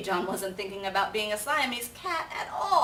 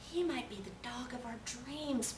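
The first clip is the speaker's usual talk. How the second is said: much softer, hushed aside; whispering; 4 semitones higher, 8 dB quieter than usual. A narrator's reading. Rosalita whispering about the kitten to the others. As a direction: breathe it to a friend. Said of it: "whispered Rosalita".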